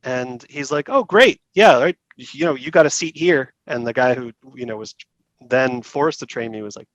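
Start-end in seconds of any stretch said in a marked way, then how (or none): 0.9-3.5 higher, louder pleased praise; the manager beams as he hands out the job; delighted manager. Retold or imitated. Manager praises the new apprentice's overhaul, delighted and loud.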